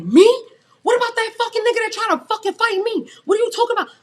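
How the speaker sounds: high, loud outraged yelling; going off. Retold or imitated. She goes off at the manager who fires her, loud. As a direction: yell back in disbelief. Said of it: "I'm just going off".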